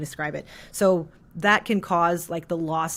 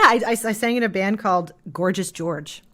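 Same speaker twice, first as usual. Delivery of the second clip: normal talking voice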